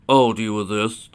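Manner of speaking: loud, slow declaration; forceful protective vow; determined resolve. Acted. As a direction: take charge, slowly and firmly. Boy steps up to attacker.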